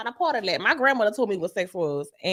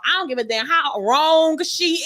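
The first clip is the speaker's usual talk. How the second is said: usual speaking voice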